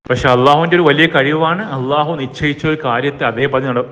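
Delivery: ordinary delivery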